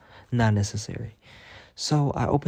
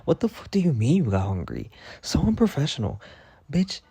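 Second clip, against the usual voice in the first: higher, heated scolding; mock outrage; 6 semitones higher than usual. To Nora at the grill, heated mock scolding. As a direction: tear into her over the bacon. Angry at coworker over bacon.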